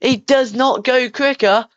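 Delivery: fast live race commentary; motorsport commentator. Acted. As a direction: call the lap like Sky F1. S0 play-by-play of lap.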